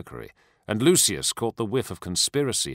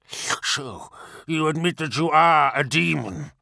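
hissed; 6 semitones higher than usual. A narrator's reading. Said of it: "hissed Caesarion".

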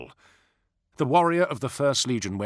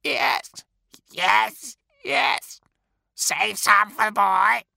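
cackling crows; 11 semitones higher, 4 dB louder, slower than usual. Acted.

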